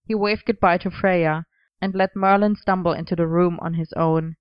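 ordinary delivery

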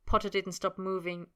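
usual speaking voice

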